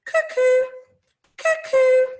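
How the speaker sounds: high, loud two-note cuckoo; high-pitched cuckoo imitation; cuckoo bird call. Shown as a demonstration. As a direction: pipe up like a clock's little bird. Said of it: "the little bird would go".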